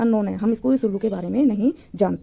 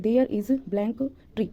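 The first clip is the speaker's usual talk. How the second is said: slow dictation; 3 dB quieter, much slower than usual. Shown as a demonstration. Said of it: "reads example sentence slowly".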